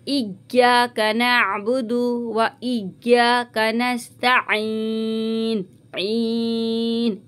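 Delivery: very slow recitation, ain made clear and ईन lengthened; tajweed recitation. Shown as a demonstration. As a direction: recite इग्याका नअबुदु with a clear ain and a long ईन. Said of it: "आइन को वाजे करके और मद्दा को खींचते हुए". (साफ़ ऐन और लंबे मद्दे के साथ धीमी तिलावत)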